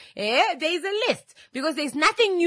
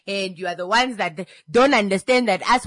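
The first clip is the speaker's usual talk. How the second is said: ordinary delivery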